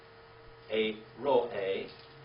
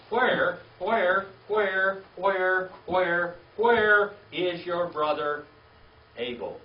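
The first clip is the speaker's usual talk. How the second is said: loud, higher, repeated where drawn out; insistent pleading call; 5 semitones higher, 8 dB louder, much slower than usual. Retold or imitated. God asking Cain over and over, loud insistent calling. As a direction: press him again and again for an answer. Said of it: "He kept asking him".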